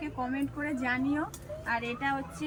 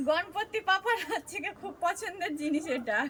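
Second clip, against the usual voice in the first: laughing; 7 semitones higher than usual. Real, not acted. Laughing reveal to viewers.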